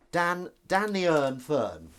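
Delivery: slow, loud, drawn-out name call; mock grand introduction; playful announcer call. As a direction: announce him like a ring entrance.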